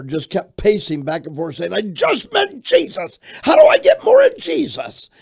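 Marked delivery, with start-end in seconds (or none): 1.7-5.1 high, loud, building to a holler; retold ecstatic shouting. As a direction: cry it out like a frantic new convert. Frantic new convert pacing, retold.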